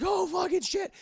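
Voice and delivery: high, loud alarmed cry; panicked Peter. Acted. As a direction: exclaim in acted horror. Peter sees ship split.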